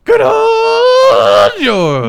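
slow, loud drawn-out cheer; hammed-up cheer. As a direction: shout it like a sitcom audience. Hammed sitcom intro.

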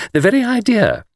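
high, mock-shocked flirt; coquettish. A narrator's reading. Miss Taylor pretending to be shocked, coquettish. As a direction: feign delighted shock in a high voice. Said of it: "cried Miss Taylor coquettishly".

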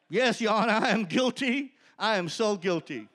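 loud, high, overjoyed yes to the judge; eager plea. Retold or imitated. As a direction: blurt it out as if it's the best news ever.

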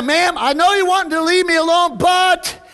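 high, loud calling protest; insistent appeal. Retold or imitated. Soul winner calling to ma'am through the door, insistent. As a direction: push on past the refusal.

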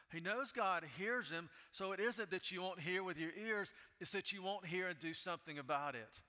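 loud, high impassioned preaching; preaching at full force. Acted. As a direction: cry it out to God with full fervor. Preaching Habakkuk's complaint at full force.

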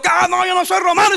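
high, quick imitation of an offended man; mock indignation. Preacher imitates an ignorant man who got offended, mock indignant. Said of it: "se enojó, dijo".

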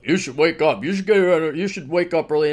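lightly voiced nagging elders; old people. Host imitating old people telling him to get up, nagging. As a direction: mimic old folks nagging, lightly put on. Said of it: "old people".

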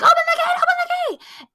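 very high, loud frantic pleading; trapped kids begging, high and loud; panicked pleading. Retold or imitated. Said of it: "They're like".

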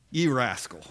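low, knowing mock scolding; amused low-pitched reproach; grudging admiration. Rich man grudgingly admires crafty manager.

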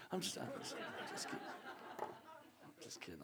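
breathy, hushed laugh through the words. Real, not acted. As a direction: giggle the joke away quietly. Joke at the congregation, then laughing.

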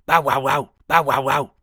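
loud barking bow wow wow; loud dog bark. A narrator's reading. Savage dog rushes at the rabbit, loud barking. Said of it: "came rushing at him with a loud".

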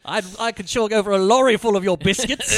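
hammed-up British slang; mock British accent. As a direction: play a hungry cartoon Brit. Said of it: "I'm British".

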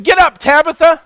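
high, loud command to rise; commanding call. Retold or imitated. Peter ordering the dead woman to rise, loud and high. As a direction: call her back to life, ringing and high.

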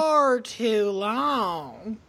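very slow, stretched-out Southern drawl; drawn-out Southern. Shown as a demonstration. Mocking a Southern accent for friends, drawn out. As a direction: drag every word in Southern style. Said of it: "things are just drawn out".

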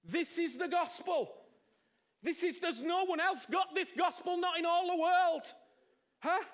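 full-throated sermon climax; preaching at full force. Real, not acted. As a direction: proclaim the gospel with fire.

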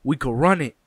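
party host daring guys to fight, loud and challenging; tough challenge. Retold or imitated. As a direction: call them out to fight. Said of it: "he's like".

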